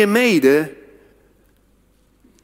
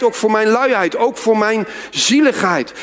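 ordinary talk, nothing marked

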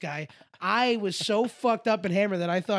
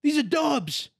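mock pep talk, slow and pitched up; pregame hype speech; 7 semitones higher, slower than usual. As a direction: imitate an athlete firing up the team. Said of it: "giving a pregame hype speech".

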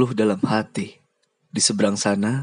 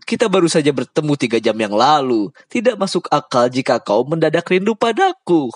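higher, louder playful ribbing; teasing Lubai; 5 semitones higher, 4 dB louder than usual. Acted. Lubai teasing Bujang about missing him already.

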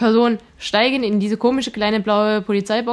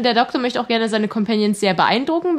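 usual speaking voice

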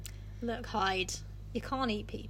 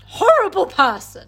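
louder, higher indignant jab; mock outrage; 7 semitones higher, 11 dB louder than usual. Friend calling Jekyll horrible, loud and indignant. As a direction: blurt it out loud in disgust.

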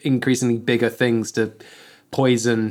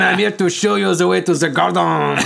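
put-on French accent; 7 semitones higher, 3 dB louder than usual. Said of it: "you have to put on a French accent".

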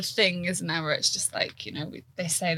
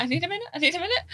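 panicked rush; 10 semitones higher, much faster than usual. Retold or imitated.

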